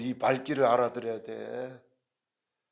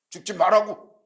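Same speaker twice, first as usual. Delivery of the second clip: loud, raised burst of protest; frantic protest; 7 semitones higher, 11 dB louder than usual. Acted. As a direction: cry out in acted frantic objection. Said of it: "이러면서 막 난리를 쳤어요". (난리 치듯 큰 소리로 말리기)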